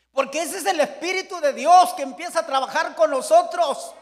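preaching at full force. Acted. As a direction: drive it home to the congregation. Preaching climax. (predicación ardiente a todo pulmón)

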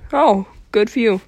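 normal talking voice

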